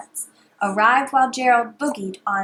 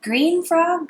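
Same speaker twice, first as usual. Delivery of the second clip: higher calling tone; sing-song call; 6 semitones higher, 4 dB louder than usual. Shown as a demonstration. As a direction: call it up high. Repeat call.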